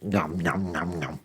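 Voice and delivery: low, soft nom-nom eating noises; quiet mock chewing sounds; mimed munching. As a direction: pretend to chomp greedily on food. Imitates Richard munching pizza.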